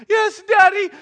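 very high, loud mimicry of a child; jeering little-kid imitation; mocking child's answer. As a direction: throw his words back at him mockingly. Dad throws son's answer back.

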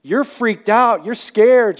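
ordinary talk, nothing marked

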